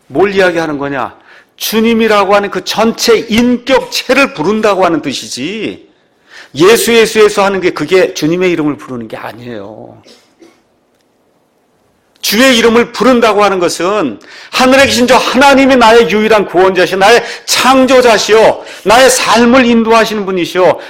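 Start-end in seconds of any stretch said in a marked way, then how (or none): 1.6-7.4 loud, high-pitched sermon delivery; full-force preaching. Real, not acted. 12.2-20.8 loud, high, sustained proclamation; impassioned confessional preaching. Acted. Preaches a confession at full force.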